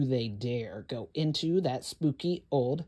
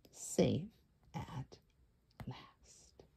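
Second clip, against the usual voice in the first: softer down to a whisper; fading to whisper; 4 semitones lower, 9 dB quieter, much slower than usual. A narrator's reading. Storyteller fading into a whisper at the happy end. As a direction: trail off gently.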